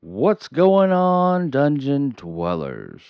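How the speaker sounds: high, loud, slow show-host call to the crowd; big hype greeting. Acted. Host greets his Dungeon Dwellers with loud, slow showman hype. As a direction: stretch out the welcome like a game-show host. Channel intro greeting to viewers.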